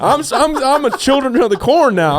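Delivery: high, loud frantic refusal; indignant shout. Retold or imitated. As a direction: yell it, terrified of getting back in.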